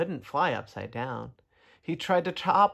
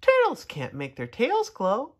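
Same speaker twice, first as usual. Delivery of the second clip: firefly voice; 8 semitones higher, 3 dB louder than usual. Acted. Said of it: "said the firefly".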